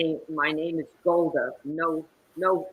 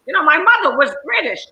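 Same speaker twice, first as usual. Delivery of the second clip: loud, high, grand British voice; mother's put-on British accent; 12 semitones higher, 12 dB louder than usual. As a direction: proclaim it in a lofty British accent. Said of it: "where'd you get that accent".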